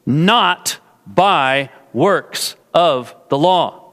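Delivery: "Not by works of the law" spaced out slowly, word by word; slow "Not ... by ... works ... of the law" with deliberate pauses; emphatic word-by-word. Shown as a demonstration. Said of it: "Paul's wanting to say it".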